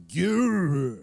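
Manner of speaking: a forced, strained yeah pushed out; strained dying yes. Retold or imitated. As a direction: squeeze the word out like a dying man. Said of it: "but he was like".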